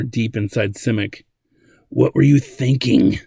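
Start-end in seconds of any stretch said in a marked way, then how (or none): 1.9-3.2 put-on louder old man's reproach; exclaiming. A narrator's reading. Old man reads the message, exclaiming. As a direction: exclaim it in mild outrage. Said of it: "the old man exclaimed".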